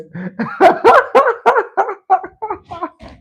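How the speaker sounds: long, high peal of laughter; real laughter. Real, not acted. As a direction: collapse into high giggles. Cracking up at Edge's voice.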